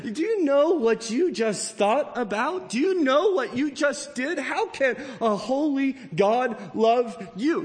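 high-pitched, incredulous accusation; acted inner accuser, pitched high and insistent; accusing voice. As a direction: needle them like a guilty conscience. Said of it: "when accusations come".

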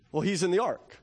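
ordinary talk

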